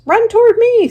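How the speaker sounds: very high, loud gander cry; the gander calls Wilbur over, very high and loud; gander calling. Acted. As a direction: honk it out. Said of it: "said the gander".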